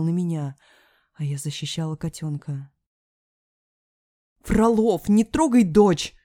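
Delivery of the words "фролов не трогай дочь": high, loud, protective shout; furious, slow command; angry warning. A narrator's reading. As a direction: shout to shield your child. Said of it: "зло обронила мама".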